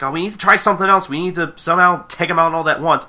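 urgent plan. Retold or imitated. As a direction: imitate a leader rallying under pressure. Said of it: "and she's like".

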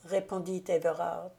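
plain reading voice

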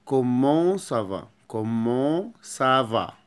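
slow, careful كومون سافا; slow teaching demo. Shown as a demonstration. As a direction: spell out كومون سافا slowly. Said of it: "مش صفاء".

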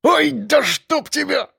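high-pitched strained yelp; wail. A narrator's reading. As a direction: cry out in sudden pain without full volume. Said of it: "раздался вопль участкового". (сдавленный вопль от боли)

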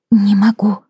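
high frightened whisper. Acted. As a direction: breathe it out, afraid. Wife repeats when asked.

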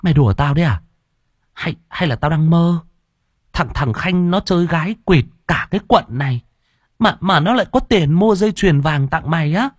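high-pitched stammering disbelief; incredulous, careful questioning. A narrator's reading. Hoa doubts her roommate got a gift from Khanh, incredulous. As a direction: question it as if you might be dreaming. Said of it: "Như không tin, Hoa cẩn thận hỏi".

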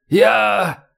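high, strained, agonised cry; wounded man crying out; moaning surrender. A narrator's reading. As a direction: groan out a surrender in pain. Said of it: "простонал полковник".

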